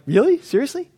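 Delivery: high incredulous "Really? Seriously?"; mock incredulous questioning; mock disbelief. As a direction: play the stunned skeptic, pitched high. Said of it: "he'd be like".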